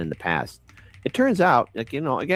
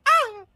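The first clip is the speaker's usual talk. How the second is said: mock shriek. Mock yell after impression.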